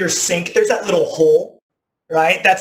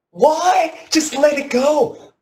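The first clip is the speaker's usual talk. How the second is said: wife pleading; 11 semitones higher, much slower than usual. Retold or imitated.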